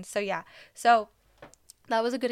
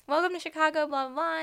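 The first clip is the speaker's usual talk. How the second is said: excited fan gush; 7 semitones higher, 6 dB louder than usual. Retold or imitated. Gushing greeting to player.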